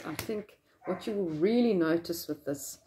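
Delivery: muffled behind cloth mask; speaking masked; through a face mask. Real, not acted.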